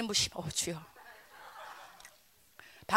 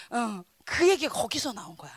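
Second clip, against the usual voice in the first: ordinary delivery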